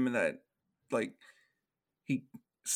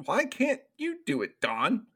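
exasperated challenge; 10 semitones higher than usual. Real, not acted. Mock complaint to absent Don.

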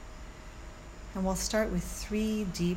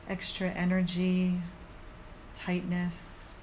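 soothing yoga teacher; 3 dB quieter than usual. Acted. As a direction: guide them calmly through the body. Leads a guided meditation.

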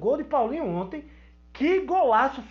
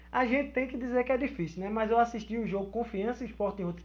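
normal talking voice

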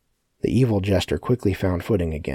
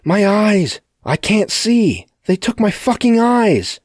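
high, loud, drawn-out scream of agony; full-voiced shriek, far above normal pitch; 8 dB louder, slower than usual. A narrator's reading. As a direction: scream it in blinded panic. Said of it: "screaming at the top of his lungs".

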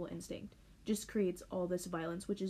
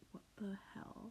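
whispered; 12 dB quieter than usual. Retold or imitated. Reacting to the book.

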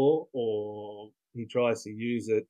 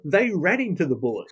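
mock driver voice; 5 semitones higher, 3 dB louder than usual. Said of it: "coming up with fake voices for him".

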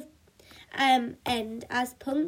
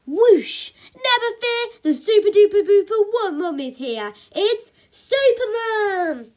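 very high, loud, triumphant cry; hammed-up hero bursting onto the scene; superhero announcement; 9 semitones higher, 10 dB louder than usual. A narrator's reading. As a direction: proclaim it hugely, stretching out the name. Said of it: "she announced".

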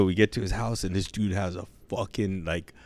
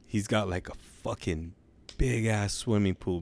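ordinary delivery

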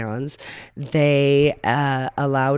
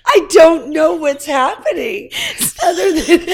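talking through a high laughing fit; can barely get words out for laughing; real laughter; laughing helplessly; 12 semitones higher, 5 dB louder than usual. Real, not acted. Laughing fit during podcast.

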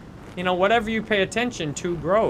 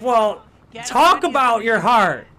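exasperated redirect; 5 semitones higher, 7 dB louder, slower than usual. Retold or imitated. Redirecting complaining family member.

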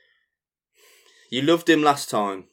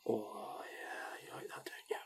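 whispered, teasing, far softer; mock seductive whisper; 19 dB quieter, slower than usual. Host pouring rum and cola, hammed-up seductive whisper. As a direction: breathe it to the drink like a tease.

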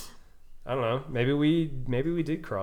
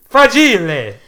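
loud, high mock Italian; Italian accent; 10 semitones higher, 16 dB louder, slower than usual. Italy shout-out, mock Italian.

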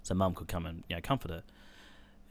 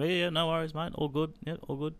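much higher, cheerful easygoing mate tone; breezy reply; 9 semitones higher than usual. Retold or imitated. Son casually answering his father's daily call, breezy and higher. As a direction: toss it off like it's no trouble.

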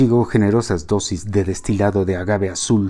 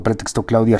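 ordinary delivery, nothing marked

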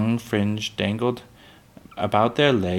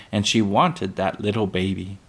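plain reading voice

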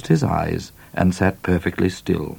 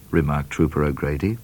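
ordinary delivery

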